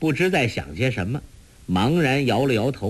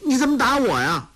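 put-on high, fast childish protest; a little boy whining at being tripped; boy complaining after being thrown down; indignant child; 12 semitones higher, faster than usual. A narrator's reading.

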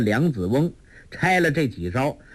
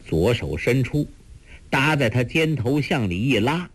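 plain reading voice

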